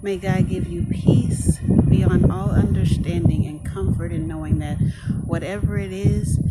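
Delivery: earnest blessing prayer, building in force; spoken prayer. Acted. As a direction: pray it over them with conviction. Prays over the viewers.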